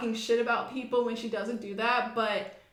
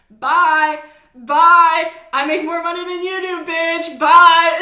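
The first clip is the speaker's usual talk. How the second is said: loud, higher mock dismissal; telling off; 6 semitones higher, 16 dB louder, slower than usual. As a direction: brush her off loudly and dismissively. Manager telling off quitting worker.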